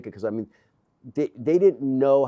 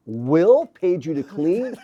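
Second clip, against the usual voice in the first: slow, raised, louder mock shock; astonished disbelief; 5 semitones higher, 5 dB louder, much slower than usual. Worker retells finding out who paid for cleaning, astonished. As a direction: draw it out in retold, wide-eyed disbelief. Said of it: "his eyes popped out".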